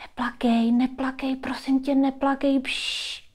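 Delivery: put-on scared child's hushed pleading; frightened soothing. A narrator's reading. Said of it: "taky vyděšeně říkáš". (vyděšené tiché konejšení)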